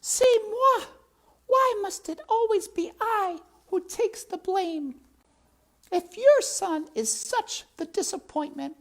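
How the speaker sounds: very high, loud French protest; indignant French. Acted. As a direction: cry it out in wounded French. Antoinette voiced in French accent.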